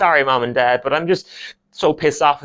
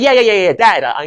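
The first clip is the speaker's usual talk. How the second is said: loud, high rush of yeses; flustered son; 8 semitones higher, 6 dB louder than usual. Retold or imitated. Son stopping dad's sex talk, loud and flustered. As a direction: cut dad off in embarrassment. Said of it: "I'm like".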